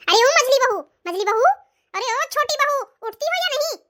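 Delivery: loud impatient summons, pitch up; calling out loud. Acted. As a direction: yell them awake from the doorway. Mother-in-law calls them again.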